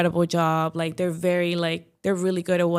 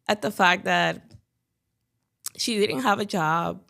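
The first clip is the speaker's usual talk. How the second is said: ordinary talk, nothing marked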